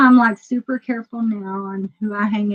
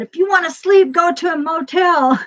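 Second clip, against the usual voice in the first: higher, louder taunting call; mock yell; 8 semitones higher, 4 dB louder than usual. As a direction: holler it at someone dozing in a car. Taunting a sleeper she's quarrelling with.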